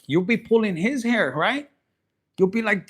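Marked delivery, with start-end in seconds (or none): none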